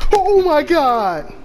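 a real jolt of fright, slow and loud; frightened gasp. Real, not acted. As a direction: let the fear stretch the words out slowly. Sees a figure in the dark.

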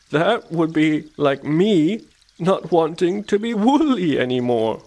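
slow, high sheep voice; puzzled sheep. A narrator's reading. Sheep compares the wish to herself, puzzled.

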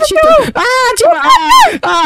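high, sudden startled cry; startled shriek. Acted. Gamer startled by Darusel bursting in, shrieking. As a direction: jump out of your skin mid-sentence.

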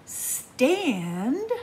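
usual speaking voice

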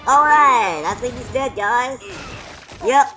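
excited. Real, not acted. Victory after beating boss.

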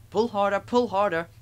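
loud, high-pitched urging; puffing engine. A narrator's reading. Thomas urging Gordon at departure, loud puffing. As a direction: push the words out in chugging rhythm. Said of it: "puffed Thomas to Gordon".

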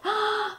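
sharp high blowing gasp; a big huffing breath. Shown as a demonstration. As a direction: blow out hard like the pig.

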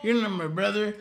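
whiny brother voice. Retold or imitated. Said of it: "And he's like".

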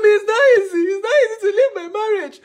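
wailing to mother that leaving is hard; imitated crying. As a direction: replay your own old sobbing to your mom. Imitating her younger self sobbing to mother.

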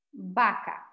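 "Vaca" baka, grave, cerrando; pronunciación final de "vaca". Shown as a demonstration. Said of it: "van a decir". (modelo final: "Vaca" con b)

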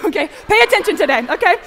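loud, high, amused telling-off; laughing mock scolding. Real, not acted. Speaker teasing the student who wrote a cynical answer, laughing scolding. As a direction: scold playfully through a laugh.

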